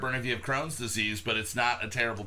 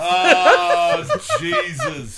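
high squealing laugh through the words; laughing hard; 9 dB louder, much slower than usual. Real, not acted. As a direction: crack up, barely get the words out. Reaction to joke punchline.

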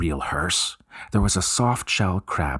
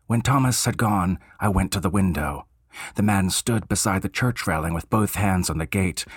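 ordinary delivery, nothing marked

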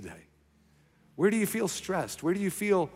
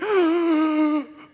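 sharp gasp of relief, much higher; high inner gasp; 13 semitones higher, 6 dB louder than usual. Retold or imitated. Pastor's inner gasp on hearing the staffer resign. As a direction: gasp as if struck by joy. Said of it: "in my heart, I'm like".

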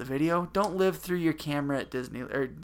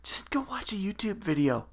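whisper; 6 semitones higher than usual. Real, not acted. Teasing cohost mid podcast.

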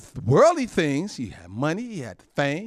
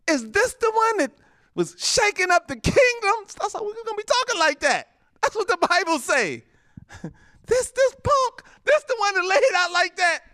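high, loud scornful jeering; mocking saints; 3 dB louder than usual. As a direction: sneer at the fallen enemy. Preacher voices mocking saints.

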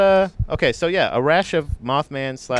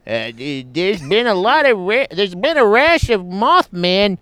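slow, higher-pitched comedian impression; a drawn-out, raised put-on voice; Norm Macdonald; 5 semitones higher, slower than usual. Host reading out a listener's question, slipping into a comedian impression. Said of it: "now I'm just doing Norm Macdonald".

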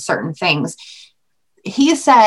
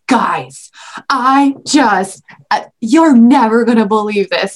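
put-on a bit louder, eager news for the kids; excited announcement; 3 dB louder than usual. Telling her kids after school, eager and a bit louder. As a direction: burst in with big news. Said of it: "I was like".

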